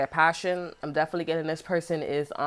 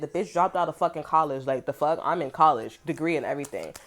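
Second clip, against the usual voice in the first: ordinary delivery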